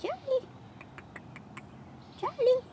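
high call with a falling end; high pet call. Real, not acted. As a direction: call his name up high to get his attention. Calling the bunny.

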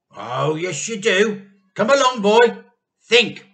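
loud impatient demand; stern demand. A narrator's reading. Mr Cranky interrogating George, loud stern demand. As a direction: press the boy hard.

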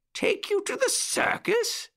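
pitched very high in surprise; astonished cry. A narrator's reading. Uncle Wiggily is asked to take the puppies, high astonished cry. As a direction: squeak the question out, aghast. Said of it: "cried Uncle Wiggily".

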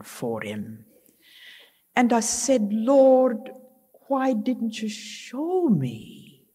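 drawn-out Scottish lilt, swooping high and falling away; Scottish accent. Retold or imitated. Widow confiding regrets to her friend, Scottish accent. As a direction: imitate a Scottish friend, lingering on key words. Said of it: "in her wonderful Scottish accent".

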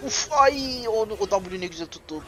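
high, loud delighted exclamation; excited cheer. Real, not acted. Gamer thrilled at a teammate's skill hit, loud delighted cheer. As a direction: cheer the great catch.

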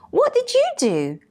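very high, incredulous question; indignant surprise. A narrator's reading. Mouse questioning whoever claimed the thanks, indignant. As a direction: challenge it high, falling at the end.

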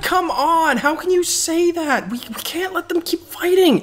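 high, urgent protest; indignant. Real, not acted. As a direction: plead against giving up. Outraged protest.

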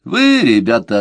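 ordinary delivery, nothing marked

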